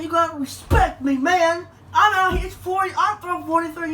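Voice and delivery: hammed-up demand for respect, a bit high and loud; imitating an irritated older guy; mock angry neighbor. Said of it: "He said".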